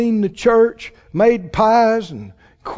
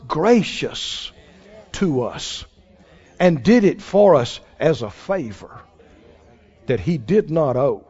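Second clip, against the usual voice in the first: soft tender; 8 semitones lower, 5 dB quieter than usual. Real, not acted. Tender close about God's favor.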